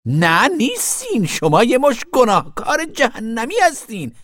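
very high, forceful damning tirade; damn them to hell in a high, furious voice; angry zealot woman. A narrator's reading. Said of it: "حالتی خشمگین یافت". (زن متعصب خشمگین با صدای زیر)

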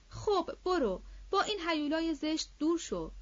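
high-pitched pretend scolding; mock anger. A narrator's reading. Mother pushing away daughter and doll, mock anger. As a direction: shoo her off with feigned crossness. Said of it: "با خشم ساختگی".

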